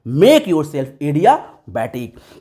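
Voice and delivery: very slow, each word spaced out; drawn-out slogan for students; slow emphatic slogan. Shown as a demonstration. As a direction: drill the motto in word by word. Slogan repeated slowly.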